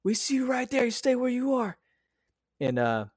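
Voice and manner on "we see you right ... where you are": imitating a cop's order, much higher; mock police call to a hiding suspect, high and sharp; cop commands. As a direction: play the officer calling out, pitched way up. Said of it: "then they were like".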